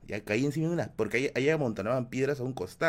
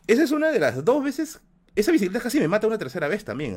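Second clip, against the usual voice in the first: excited exclaim; 9 semitones higher, 6 dB louder than usual. Real, not acted. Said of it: "excited aside to viewers".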